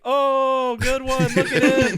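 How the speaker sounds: loud, high laughing taunt; hammed-up "ooh, good one"; mock jeering. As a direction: crow over a burn, imitating the crowd. Retelling a taunting comeback.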